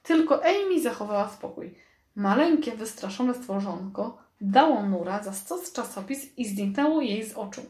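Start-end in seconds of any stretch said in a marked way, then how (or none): none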